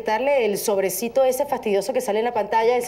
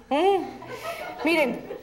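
usual speaking voice

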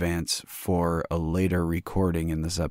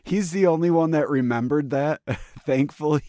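high, laughing relief; 7 semitones higher, 4 dB louder than usual. Real, not acted. Laughing with his co-host about the one listener who remembered music requests. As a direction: laugh it out, much higher and louder.

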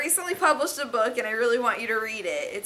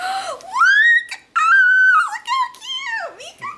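cute-overload squeal; 9 dB louder than usual. Real, not acted. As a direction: coo in a high squeal of delight. Cooing over a cute gift.

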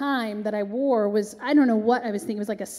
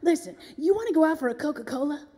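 higher, quick star impression; 5 semitones higher, faster than usual. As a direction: toss out a rapid invitation as the diva. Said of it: "And she goes".

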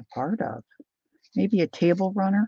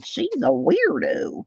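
imagined teasing viewer; 10 semitones higher, slower than usual. Retold or imitated. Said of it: "Are you giggling and saying".

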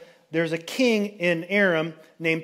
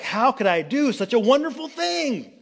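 much higher, faux-astonished disbelief; mock innocence; 7 semitones higher than usual. Hazel hears the prophecy and gushes, much higher mock innocence. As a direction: gush as if it's flattering.

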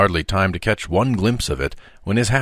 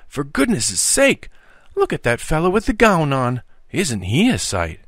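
burst out; 8 semitones higher than usual. Acted. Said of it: "he at length burst out".

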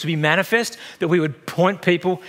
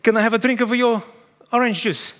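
put-on higher-pitched, clumsy Italian accent; 6 semitones higher than usual. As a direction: attempt a bad Italian accent. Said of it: "if I try to do a, an Italian accent".

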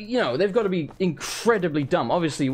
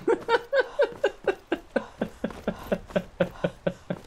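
high giggle; 5 dB quieter than usual. Real, not acted. Laughing at his own reading.

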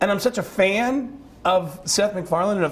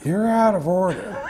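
slow, drawn-out courtroom outburst, higher pitch; Al Pacino; 4 semitones higher, slower than usual. Acted. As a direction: stretch each word like a ranting movie star. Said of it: "as Al Pacino".

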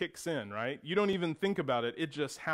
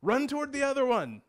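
brothers calling out; 10 semitones higher, 4 dB louder, faster than usual. Retold or imitated. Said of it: "And so they'd go".

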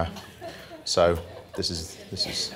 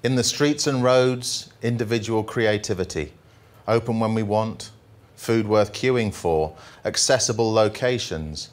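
jolly poem reading; 2 semitones higher than usual. Acted. Comic poet reading the happy poem to the audience, upbeat. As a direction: read it like a cheerful ode.

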